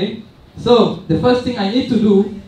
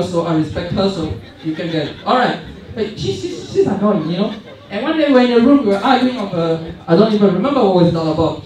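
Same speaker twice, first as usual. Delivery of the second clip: ordinary delivery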